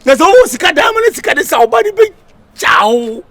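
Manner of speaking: loud, high ranting; shouting. Acted. Hype man ranting loudly to the crowd. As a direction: rant loudly.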